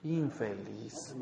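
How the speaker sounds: very low, soft, drawn-out curse; muttered resentment. Acted. Wife left waiting at home, resentfully cursing her husband. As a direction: grumble it slowly under the breath.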